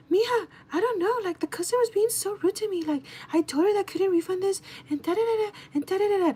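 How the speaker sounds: high-pitched mimicked excuse; coworker's put-on voice. Older coworker blaming the customer to mija, put-on voice. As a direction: imitate her rattling off excuses, very high. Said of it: "that's the voice she makes".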